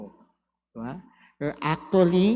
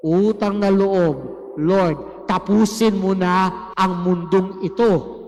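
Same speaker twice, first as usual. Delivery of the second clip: desperate begging, louder with each plea; pleading with the Lord; 3 dB louder than usual. Retold or imitated. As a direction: beg him to end it all, building up. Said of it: "you are actually telling our Lord". (pagmamakaawang palakas nang palakas)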